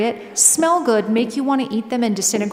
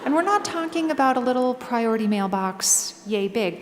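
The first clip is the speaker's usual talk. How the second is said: ordinary talk, nothing marked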